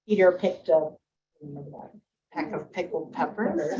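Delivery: soft, trailing tongue twister. Shown as a demonstration. Teacher quoting a tongue twister, softly trailing off. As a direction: trail off the Peter Piper line softly. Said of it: "like a tongue twister".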